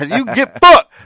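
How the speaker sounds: high, loud put-on yell; mock angry retort. Friend echoing Jane's retort, mock angry. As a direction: holler the comeback playfully.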